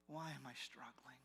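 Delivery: whisper. Acted. Doubter voiced in sermon.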